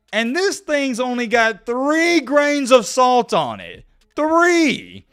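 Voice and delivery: high, loud, slow indignation; pissed-off outrage. Retold or imitated. Ranting to viewers about a barely salted pretzel, pissed-off outrage. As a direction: stretch it out in outraged disbelief. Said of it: "how much that pissed me off".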